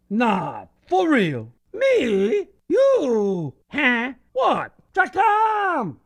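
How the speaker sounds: comic auntie yelling names from the porch; yelled roll call. Acted. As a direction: holler each name separately. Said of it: "would yell even more loud".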